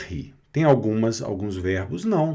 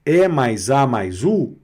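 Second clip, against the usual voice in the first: ordinary delivery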